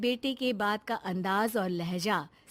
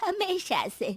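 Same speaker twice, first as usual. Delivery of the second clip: fussing mother; 4 semitones higher than usual. Acted. Mother greeting her returned son, fondly concerned. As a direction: fret tenderly over a grown son.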